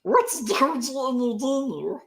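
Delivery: high, hammed-up Gollum-like croak, mocking the coin name; silly creature voice. Reading a viewer's coin name to his co-host, silly Gollum voice. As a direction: sneer the name like a greedy little creature. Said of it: "The way you say it is so funny".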